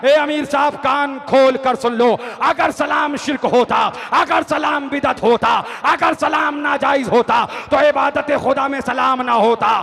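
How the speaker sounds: loud, high, hammering preacher's climax; triumphant full-voice declamation; defiant shouted rebuke. Acted. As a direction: throw each argument in the leader's face. Acted confrontation at sermon climax.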